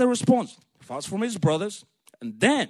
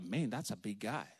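lower, much softer inner voice; awed aside; 9 semitones lower, 9 dB quieter than usual. Acted. Young David sizing up the giant, awed aside. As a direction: think it under your breath. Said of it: "he's looking at him like".

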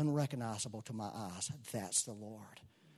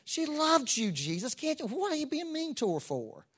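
lightly voiced reproach, rising high; a hint of a scolding complaint; mock indignation; 5 semitones higher than usual. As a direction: tease the reader's outrage. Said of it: "At first you read that like".

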